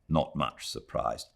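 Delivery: usual speaking voice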